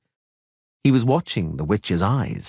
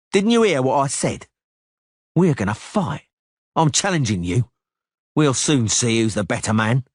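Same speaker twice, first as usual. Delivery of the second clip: raised, cocky duelist voice; Cicada's challenge; 4 semitones higher than usual. Acted. Cicada challenging the witcher to a duel, raised taunt. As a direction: throw down the gauntlet. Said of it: "narrator voicing Cicada".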